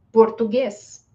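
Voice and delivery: "português" with narrow stressed E; closed E. Shown as a demonstration. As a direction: say "português" as por-too-GAYS, without a glide. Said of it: "este E é pronunciado como E".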